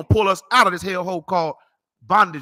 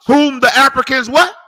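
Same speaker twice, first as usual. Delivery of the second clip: high, booming call-back question; loud challenge, rising on the last word; preacher's loud prompt; 6 semitones higher, 10 dB louder than usual. Real, not acted. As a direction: shout it out for the reader to answer. Preacher prompts reader to repeat.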